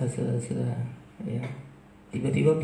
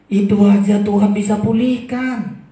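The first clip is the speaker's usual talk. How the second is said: high, loud declaration; emphatic preaching; 9 semitones higher, 10 dB louder than usual. Acted. Preacher assuring the congregation God restores, loud declaration. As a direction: proclaim it with full force.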